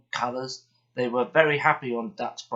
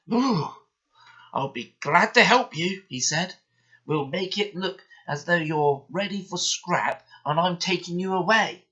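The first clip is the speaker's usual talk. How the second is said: high, eager, kindly engine voice; Douglas the engine; 6 semitones higher, 4 dB louder than usual. A narrator's reading. Narrator as Douglas offering to rescue Oliver, high and eager. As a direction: sound glad to help a frightened friend.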